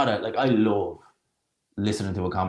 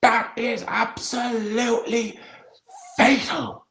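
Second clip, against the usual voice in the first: hammed-up imitation of an old darts commentator; dramatic commentator; 14 semitones higher, slower than usual. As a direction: stretch each word like a legendary commentator. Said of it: "He's like".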